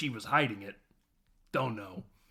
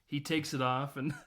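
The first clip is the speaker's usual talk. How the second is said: ordinary delivery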